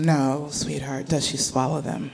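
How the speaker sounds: soft, slow, gentle; hushed, measured reassurance; 1 calm soothing mom. Retold or imitated. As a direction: soothe it like a patient mother. Mother calmly deflecting.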